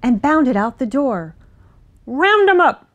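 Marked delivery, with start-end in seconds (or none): 2.0-2.9 high, loud call across the field; farmer calling out. A narrator's reading. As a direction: call an order to the dog, raised and urgent. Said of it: "the farmer called".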